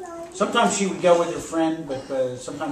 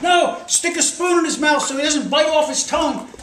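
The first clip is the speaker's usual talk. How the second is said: high, loud frantic instruction; urgent retold order; 13 semitones higher, 7 dB louder than usual. Storyteller voices bystander demanding a spoon, urgent high shout. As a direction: shout advice like an alarmed bystander.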